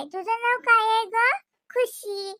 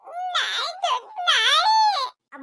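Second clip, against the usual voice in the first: wailing refusal through tears; tearful shout of no; crying no; 9 semitones higher, much slower than usual. Real, not acted.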